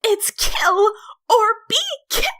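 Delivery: high piercing shriek, broken off mid-word; Flowey's shriek cut off. A narrator's reading. The flower shrieks at the human, cut off. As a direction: shriek it, then choke off. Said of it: "Flowey shrieked".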